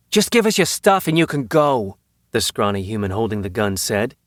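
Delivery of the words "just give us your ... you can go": higher-pitched mugger's order; demanding robber. A narrator's reading. Gunman to two cornered tourists, demanding. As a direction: press the victims to hand it over.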